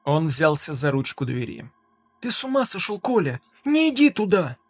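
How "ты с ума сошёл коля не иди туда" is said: frightened plea. Acted. Wife begs husband not go.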